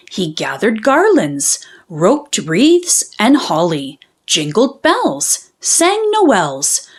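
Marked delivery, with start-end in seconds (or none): none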